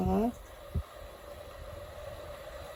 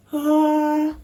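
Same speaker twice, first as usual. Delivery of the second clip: loud, raised, drawn-out "ah"; stressed wail; 5 semitones higher, 8 dB louder than usual. Real, not acted. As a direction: let out a long cry of stress. Stressed outburst over the jar.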